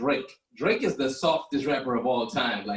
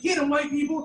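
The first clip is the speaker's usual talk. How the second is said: mock cheering; 14 semitones higher than usual. Cheering cops on from couch.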